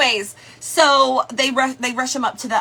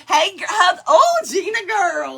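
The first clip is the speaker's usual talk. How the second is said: usual speaking voice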